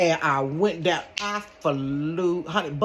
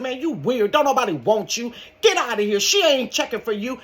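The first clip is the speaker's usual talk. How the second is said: retold telling-off; 6 semitones higher than usual. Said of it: "I go ballistic".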